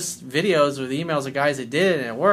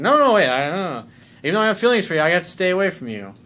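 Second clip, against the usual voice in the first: higher, louder imitation of backing off in alarm; imitated flustered protest, pitched up; mock flustered backpedal; 4 semitones higher, 5 dB louder, faster than usual. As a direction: act out a hasty change of mind. Voices her sobered-up backpedal.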